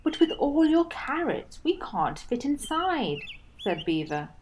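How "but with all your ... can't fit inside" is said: bewildered. Acted. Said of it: "a bit bewildered".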